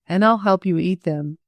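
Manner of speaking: usual speaking voice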